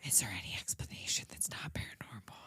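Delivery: hushed mock-secret whisper. Imitating investigators' whisper meeting, hushed whisper. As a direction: whisper like a huddled conspirator. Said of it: "they have a little whisper meeting".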